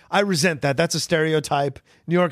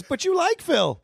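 mock wife voice; slower than usual. Host imitates wife.

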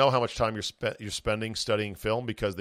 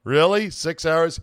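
high, raised mock disbelief; incredulous buddy; 5 semitones higher, 5 dB louder, slower than usual. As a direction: play a friend balking in surprise. Acts buddy asked to scroll plays.

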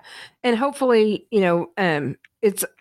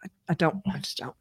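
trailing off, almost silent; whispered, exhausted refusal; fading to a whisper; 3 semitones lower, 9 dB quieter, faster than usual. Real, not acted. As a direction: let the words die away.

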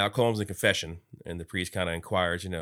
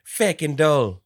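loud, higher mimicked film quote; mock outburst; 7 semitones higher, 10 dB louder, much slower than usual. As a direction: echo the outburst loudly, imitating. Echoing the shouted film line.

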